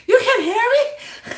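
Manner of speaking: high-pitched, tearful mock plea; hammed-up wail. Shaken wife to her husband who moseys up, mock wailing. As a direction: cry it out in comic disbelief. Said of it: "And I'm like".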